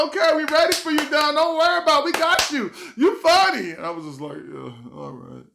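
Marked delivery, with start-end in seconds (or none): 0.0-3.7 high loud fast mock parents; cheering parents. Parents cheering on son, high mock voice. 4.4-5.5 slow quiet nervous reply. Retold or imitated. Nervous comic answering parents, slow quiet. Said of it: "I'm nervous".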